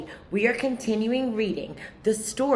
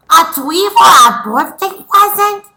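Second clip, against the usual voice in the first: put-on higher, louder toddler echo; little brother echoing; 10 semitones higher, 18 dB louder than usual. Toddler Huey repeating his brother, higher and louder. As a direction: copy big brother like a two-year-old. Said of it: "Huey said".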